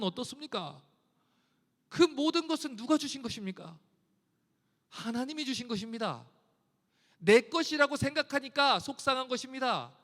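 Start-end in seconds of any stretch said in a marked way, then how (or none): none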